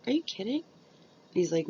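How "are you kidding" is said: high, delighted disbelief; surprised. Retold or imitated. Mother answering her son's offer, high and surprised. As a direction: squeal it in happy shock. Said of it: "And I was like".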